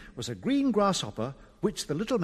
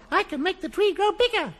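high, small goblin voice; little goblin; 12 semitones higher than usual. A narrator's reading. Goblin introducing himself to the children, little high voice. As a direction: introduce yourself in a tiny high voice. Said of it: "a little voice said".

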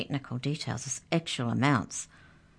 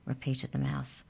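ordinary delivery, nothing marked

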